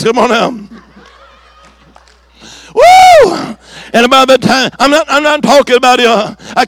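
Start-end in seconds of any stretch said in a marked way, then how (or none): 2.8-3.6 ecstatic falsetto whoop; high whoop. Real, not acted. Preacher whooping at the healing, high and loud. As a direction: let out a high joyful woo.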